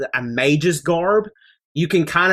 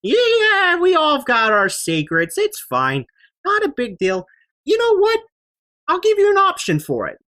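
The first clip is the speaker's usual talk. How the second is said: put-on high old woman, still giggling; old lady after laughing; 9 semitones higher than usual. Alchemist haggles with the mouse, high old lady voice. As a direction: bargain like a cackling old crone. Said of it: "It's like".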